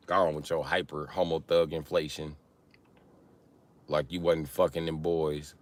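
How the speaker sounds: usual speaking voice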